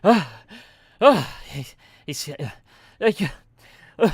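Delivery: panting, broken starts ending in a loud cough; out of breath, coughing. Acted. Frightened runner trying to catch his breath, winded and coughing. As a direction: try to speak while catching your breath. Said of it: "coughs a little bit".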